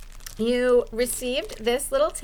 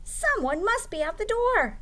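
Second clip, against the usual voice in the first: a hint of a curious owl, higher; puzzled owl calling toward the door; lightly voiced owl; 10 semitones higher than usual. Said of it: "said Owl".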